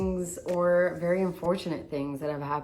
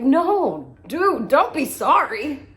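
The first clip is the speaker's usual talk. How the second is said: higher, louder, slow caring; concerned reassurance; 6 semitones higher, 7 dB louder, slower than usual. Retold or imitated. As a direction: comfort her gently but urgently. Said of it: "I was like".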